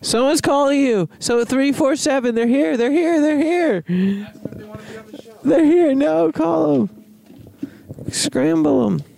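high, urgent comic panic; frantic high voice. Acted. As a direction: sound panicked and silly.

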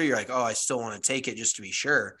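ordinary talk

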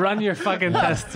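slow put-on voice, laughing; laughing mock order. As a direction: drag it out in a goofy voice. Mock voice continues.

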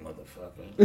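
a hushed under-the-breath curse; whispered curse. Retold or imitated. Sales staff cursing the boss under their breath. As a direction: mutter it so the boss can't hear. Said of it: "and they like".

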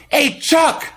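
high, loud call across the house; yelling boss. Retold or imitated. Mover boss yelling at his worker, high and loud. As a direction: shout after a worker walking off. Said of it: "literally starts yelling at the other guy".

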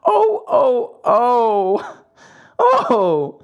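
high, drawn-out theatrical "oh" sobs; crying wail. A narrator's reading. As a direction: cry out like a bawling puppet child. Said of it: "began to scream and cry".

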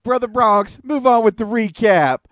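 high-pitched mock preacher call; Brother Love. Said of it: "Fucking Brother Love".

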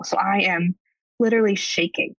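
ordinary talk

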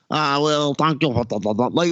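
mock the lady cop's mouthful speech; garbled, as if sucking a lozenge; lozenge-in-mouth talk. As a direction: talk round a cough drop, imitating her. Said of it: "she talks like she's eating a lozenge".

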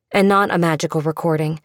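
ordinary delivery, nothing marked

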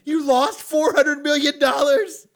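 slow, high, very loud mock yell; hammed-up movie shouting; outraged disbelief. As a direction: holler it in comic outrage. Acts out outraged Rocky scene.

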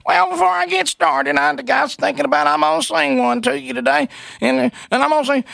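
high mock voice of a radio host's wife; lifted, chatty mimic of the wife; imitating the wife. Telling callers about the song, in a high mock wife voice. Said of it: "she goes".

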